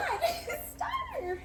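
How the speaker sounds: joyful cry. Retold or imitated. Relieved at seeing Star in the car.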